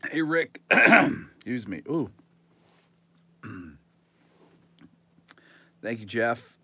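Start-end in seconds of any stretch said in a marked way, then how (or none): 0.7-3.8 clearing throat. Real, not acted. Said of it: "That went down the wrong pipe".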